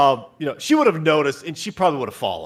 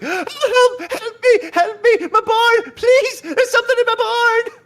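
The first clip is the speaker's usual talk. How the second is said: high, slow, wailing plea for help; frantic crying woman; 6 dB louder than usual. Acted. Farm woman bursting into the church, wailing high for help. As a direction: beg for help in a panicked falsetto. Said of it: "this woman is crying".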